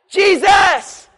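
loud cry of the name, high and loud; calling out Jesus. Retold or imitated. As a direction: cry out the name like a desperate plea. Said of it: "they called out".

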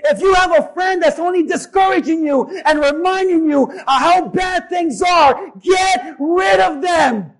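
high, loud shouted exhortation; rally the congregation at the top of your voice; full-force preaching. Real, not acted.

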